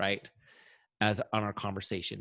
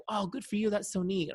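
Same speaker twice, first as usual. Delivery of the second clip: much higher, gushing delight; enthusiastic; 9 semitones higher than usual. Retold or imitated. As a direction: gush like a thrilled friend. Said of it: "if somebody's really enthusiastic".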